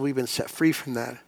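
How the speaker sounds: ordinary talk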